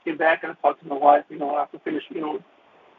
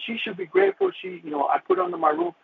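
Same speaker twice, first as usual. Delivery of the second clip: retold outburst; 11 semitones higher than usual. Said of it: "I just exploded".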